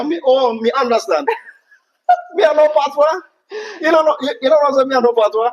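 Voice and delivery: high, loud playful patois; mock Jamaican patois; Jamaican patois accent. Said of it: "You understand what I'm just saying?".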